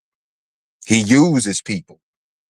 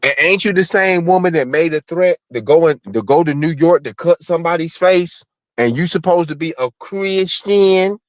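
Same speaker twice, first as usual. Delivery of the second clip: calling her out; 9 semitones higher than usual. Real, not acted. Host calling out a critic.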